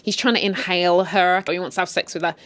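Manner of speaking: usual speaking voice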